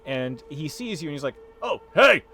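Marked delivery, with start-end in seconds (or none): 1.6-2.2 loud high shout to a passerby; calling out. Acted. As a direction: hail them loudly, pitched up, as a half-orc butcher. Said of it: "he starts to call out to you".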